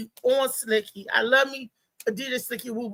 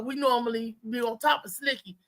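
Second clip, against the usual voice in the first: ordinary talk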